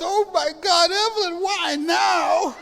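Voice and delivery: high wailing cry, half laughing; hammed-up grieving wail; crying out to the dead, half in jest; mock wailing grief. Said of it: "So I was crying so hard".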